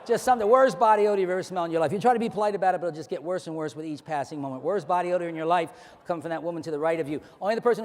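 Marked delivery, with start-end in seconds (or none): none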